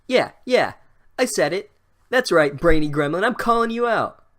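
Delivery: high, loud bravado; mock defiant callout. Real, not acted. Reader defying the brainy pixie, loud and high. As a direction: puff up and call him out. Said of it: "narrator calls out pixie".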